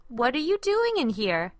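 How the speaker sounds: mom's voice, gently puzzled; a mother finding her son in the messy kitchen; mild mother's question. A narrator's reading. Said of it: "she asked".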